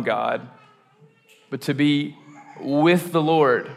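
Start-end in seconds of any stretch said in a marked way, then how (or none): 1.5-3.7 slow, lifted "with the Lord"; higher, drawn-out earnest emphasis; stressed with the Lord. Shown as a demonstration. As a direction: stretch out "with the Lord" for weight. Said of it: "the emphasis of this series".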